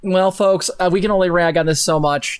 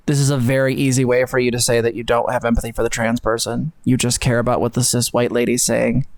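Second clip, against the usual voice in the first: retold reply; 6 semitones lower than usual. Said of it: "I'm like".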